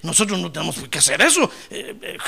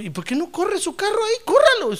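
mock tempter; 13 semitones higher than usual. Voiced tempter.

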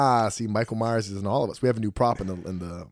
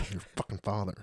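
inner grumble; 10 dB quieter than usual. Retold or imitated. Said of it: "in my head, I'm like".

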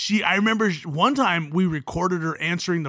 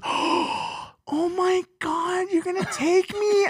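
high, airy gasping delight; excited girl impression; 9 semitones higher, 4 dB quieter, slower than usual. Girl accepting a concert invite, mock-thrilled high voice. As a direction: squeal it softly in falsetto.

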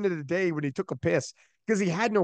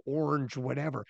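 ordinary delivery

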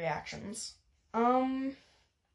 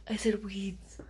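mock embarrassed hush; whispered aside; 3 semitones lower, 4 dB quieter than usual. Real, not acted. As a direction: whisper "I said weeds" under your breath. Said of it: "whispered aside".

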